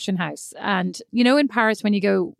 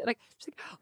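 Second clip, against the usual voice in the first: usual speaking voice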